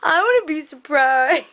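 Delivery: playful put-on pouty whine; imitating herself sulking; mock pouting. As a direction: act out a little pout, pleading. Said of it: "'cause I was pouting".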